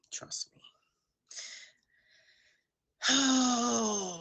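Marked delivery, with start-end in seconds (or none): 0.1-0.6 breathy whisper, much softer. Shown as a demonstration. Host repeats her reassurance to viewers as a whisper. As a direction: drop it to a hush for the repeat.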